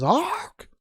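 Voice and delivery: high, loud squawk like a parrot; hammed-up parrot awk. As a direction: screech it like a bird. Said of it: "The bird says".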